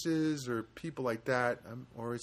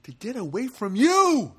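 higher, louder, slow punchline; mock outburst; 10 semitones higher, 8 dB louder than usual. Teller mocking the widow to the crowd, loud and slow. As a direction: hammer the joke home, slowly.

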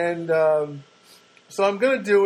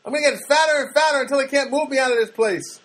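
high, loud mock protester voice; a donut hunger striker, much higher and louder; defiant donut striker; 11 semitones higher, 4 dB louder than usual. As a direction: ham up a stubborn protester refusing to budge. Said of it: "where they're just".

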